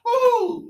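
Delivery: high loud whoop; excited whoop. Real, not acted. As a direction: whoop at the strike. Fish strikes, whoop.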